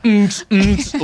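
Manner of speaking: high rhythmic "mm" beats; dancing grunts. Retold or imitated. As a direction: grunt along to an imagined beat. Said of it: "I'm just dancing to it".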